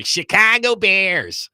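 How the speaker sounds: put-on old Chicago accent. Recalling college classmates mocking him, his old thick Chicago accent. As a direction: show how he used to say it. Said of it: "they just killed me".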